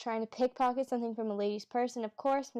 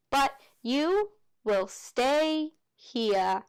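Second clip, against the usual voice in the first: very slow, louder, drawn-out command; stern teacher; 3 semitones higher, 4 dB louder, much slower than usual. Acted. Teacher ordering Grover to stay, slow stern menace. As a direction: lay down the law, word by word.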